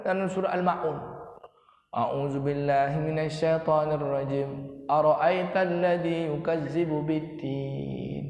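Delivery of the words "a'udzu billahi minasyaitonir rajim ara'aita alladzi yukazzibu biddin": slow, drawn-out Arabic recitation; measured sacred recitation; Quran recitation. Real, not acted. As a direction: recite the verse slowly, stretching the vowels.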